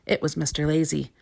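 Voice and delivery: usual speaking voice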